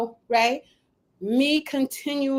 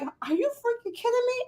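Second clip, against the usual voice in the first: mock disbelief; 10 semitones higher, 6 dB quieter than usual. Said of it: "I'm looking at them like".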